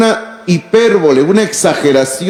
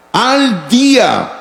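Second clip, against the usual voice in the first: slow, drawn-out stress on "Al día"; emphatic slow; 4 semitones higher, much slower than usual. Real, not acted. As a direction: lean hard on "Al día", word by word. Preacher stresses key phrase. ("Al día" lento y enfático)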